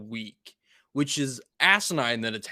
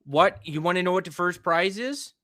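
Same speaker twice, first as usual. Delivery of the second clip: Wisconsin local, pushing the question; lilting put-on Wisconsin accent; 3 semitones higher, 4 dB louder than usual. Local setting up prize joke, Wisconsin accent. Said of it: "with that accent".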